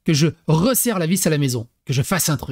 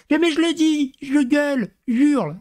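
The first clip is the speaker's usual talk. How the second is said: much higher whiny mimic; whiny wife protesting; 8 semitones higher than usual. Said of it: "Tu le". (la femme qui proteste, voix aiguë et geignarde)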